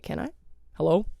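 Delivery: usual speaking voice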